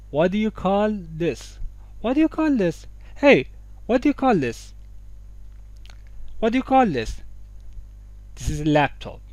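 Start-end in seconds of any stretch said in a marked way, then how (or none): 0.1-1.6 slow "waddiya... call... this"; آروم و شمرده. Shown as a demonstration. 2.0-7.2 سوال نمایشی. Pretend. Acting out asking about an object.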